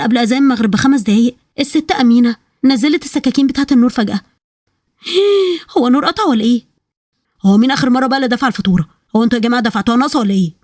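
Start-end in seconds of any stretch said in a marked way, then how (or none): 5.1-5.7 sharp high gasp of fright; a sudden frightened intake of breath; startled gasp. Acted. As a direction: gasp as the lights die. Lights suddenly cut.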